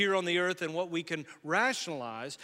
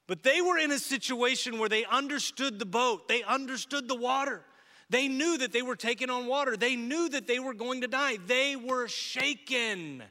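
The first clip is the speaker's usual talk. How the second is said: louder and higher, building urgency; rising intensity; 6 semitones higher, 4 dB louder than usual. Acted. Pastor preaching the disciples' fear, louder and higher. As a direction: preach it up into alarm.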